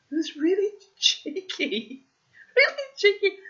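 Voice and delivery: high, loud, laughing through it; can't stop laughing over how cheeky he was; giggling, delighted. Real, not acted. Laughing at the memory.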